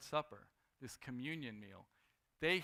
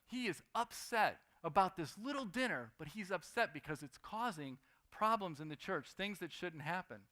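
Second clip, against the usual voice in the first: ordinary talk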